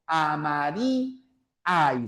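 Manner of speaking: "Amarí, hay" slow, vowels pulled apart; split at the hiatus. Shown as a demonstration. Teacher showing a hiatus, "Amarí, hay" slowly split in two. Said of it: "Eso es un hiato".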